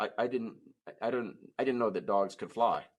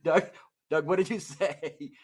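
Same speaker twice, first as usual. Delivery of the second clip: ordinary delivery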